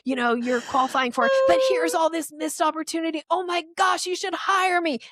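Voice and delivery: mock over-eager pitch, very high; dog-calling energy. Pushy emailer begging to be hired, dog-calling energy. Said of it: "that is dog calling energy".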